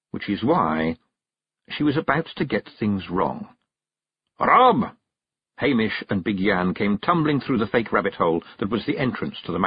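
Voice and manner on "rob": high, loud call of a name; urgent yell across the mound. Acted. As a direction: shout to get his attention. Feegle calls out on arrival.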